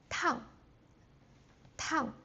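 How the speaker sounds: tang falling sharply from high, fourth tone. Shown as a demonstration. Teacher shows tang meaning hot, falling sharply. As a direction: drop tang fast from the top. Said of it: "fourth tone ... going down really quick".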